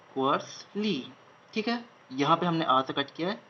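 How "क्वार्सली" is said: slow dictation. Shown as a demonstration. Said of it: "slow repeat of dictated word".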